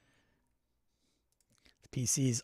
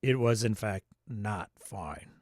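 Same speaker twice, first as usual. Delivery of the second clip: Morgan Freeman narration; 5 semitones lower, slower than usual. Retold or imitated. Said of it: "In Morgan Freeman narration voice".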